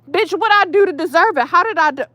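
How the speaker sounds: high-pitched, indignant comeback; indignant retort. Pretend. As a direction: fire back at the neighbor, affronted and high. Indignant imagined retort to neighbor.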